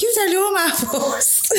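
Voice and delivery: high, giggly sing-song retort; playful teasing comeback. Retold or imitated. Teasing her boyfriend back about telling her ma, playful. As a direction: toss it back at him, laughing.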